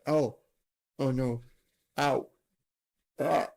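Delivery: repeated pained yelps; sharp cries of real pain. Real, not acted. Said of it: "I bit my tongue".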